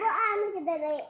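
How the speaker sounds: rattled off quickly; fast repeat. Real, not acted. Little girl repeating her question, fast. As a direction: fire the question again, fast.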